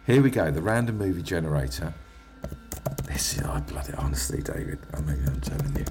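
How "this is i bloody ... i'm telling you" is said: hushed whisper, breathy and tense. Real, not acted. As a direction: whisper it nervously. Tense whisper before generator.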